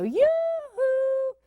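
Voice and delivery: high sing-song yoo-hoo call. Retold or imitated. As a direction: call out brightly across the driveway. Aunt calling nephew in driveway.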